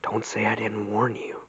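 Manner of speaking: soft whisper in the ear; old friend whispering a warning during a hug. Retold or imitated. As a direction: murmur it close, a friendly warning. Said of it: "In my ear, he whispered".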